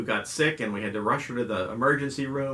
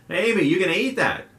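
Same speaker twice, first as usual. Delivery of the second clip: higher, louder teasing call from the back seat; teasing yell; 9 semitones higher, 6 dB louder than usual. Retold or imitated. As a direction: call out to tease her. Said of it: "a voice from the back yells".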